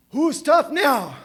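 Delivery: triumphant mock challenge; defiant taunt. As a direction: throw it up to the sky. Taunting dead drinking buddies.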